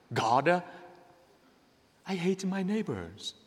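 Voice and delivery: soft, halting plea to God; hushed confession in prayer; acted prayer. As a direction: pray quietly, pausing between short pleas. Voices a private prayer to God.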